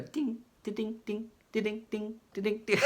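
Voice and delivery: high-pitched sung ding-da-ding riff; playful guitar-riff imitation. Reviewer shows how playful guitar riffs go, high imitated dinging. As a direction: mimic a bouncy guitar lick in a high little voice. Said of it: "The guitar riffs are just so playful".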